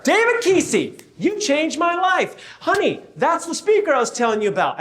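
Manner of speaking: high excited calling out across the room; mock fan yelling his name; imitating a starstruck fan shouting. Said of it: "this guy yells out of nowhere".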